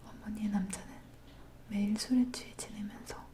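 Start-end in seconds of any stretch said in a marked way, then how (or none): none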